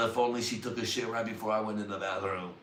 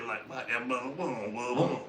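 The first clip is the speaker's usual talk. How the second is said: ordinary talk, nothing marked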